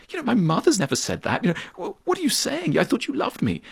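mock offended. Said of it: "the person would say".

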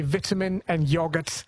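"vitamin" and "yogurt" with short first vowels; English pronunciation. Shown as a demonstration. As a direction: say VIT-amin and YOG-urt, English style. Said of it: "I think the English say".

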